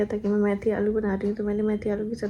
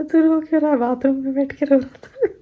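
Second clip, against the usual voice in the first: raised, louder teasing voice; mock scolding; 3 semitones higher, 7 dB louder than usual. Husband teasing the crying boy, loud mock scold. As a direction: scold him half-jokingly, pitched up.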